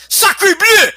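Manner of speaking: high, loud hammed-up French cry; French: exclamation théâtrale; mock French exclamation. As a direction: shout it like a cartoon Frenchman. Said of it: "the French effect".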